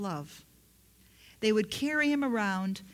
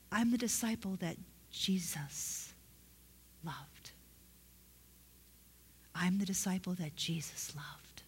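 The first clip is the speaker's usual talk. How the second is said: hushed, halting awe, fading softer; humbly grateful; 2 semitones lower, 6 dB quieter than usual. Shown as a demonstration. Preacher showing how John really said it, humbly grateful. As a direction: pause before the last word, letting it sink in softly. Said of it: "he said it like that because he understood that Jesus loved him".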